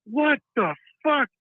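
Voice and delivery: high, drawn-out shocked cry; startled outburst. Retold or imitated. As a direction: blurt it out in shock. Startled at hissing in stall.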